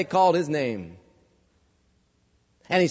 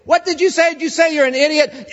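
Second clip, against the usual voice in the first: incredulous judge; 9 semitones higher, 4 dB louder than usual. Retold or imitated. Judge questions the defendant.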